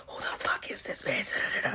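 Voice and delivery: hushed mock yell; whispered mock scream. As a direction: act out screaming without the volume. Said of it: "we're screaming at each other".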